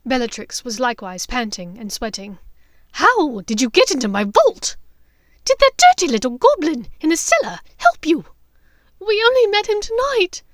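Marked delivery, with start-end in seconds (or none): 2.9-8.3 loud, higher angry questioning; angry interrogation. Acted. Bellatrix questioning Hermione about the goblin. 9.0-10.4 high, loud sobbing answer. Acted. Hermione sobbing to Bellatrix. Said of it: "Hermione sobbed".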